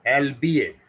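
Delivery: albeit said as "elbiee", clear and careful; teacher demonstrates albeit; model pronunciation. Shown as a demonstration. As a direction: model albeit slowly for the class. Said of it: "इसको पढ़ते हैं".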